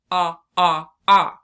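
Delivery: loud, wide-open ah; loud open vowel. Shown as a demonstration. Teacher showing the wide short O sound. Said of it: "open our mouth wide".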